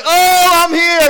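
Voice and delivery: high, loud, slow hippie sing-song; a dreamy free spirit drawing out Oh, I'm here; carefree free spirit. Retold or imitated. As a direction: wave it up lazily like at a concert. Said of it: "the free spirits are like".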